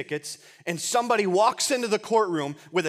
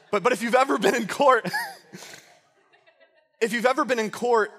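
laughing through the words, higher; real amusement; 5 semitones higher, 4 dB louder than usual. Real, not acted. Preacher joking to congregation about being in court, laughing. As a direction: try to restart the sentence through a laugh.

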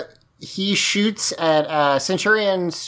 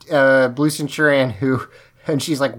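ordinary delivery

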